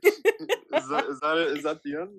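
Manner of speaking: high, fast and laughing. Real, not acted. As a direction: giggle through the awkward question. Laughing at their awkward ending.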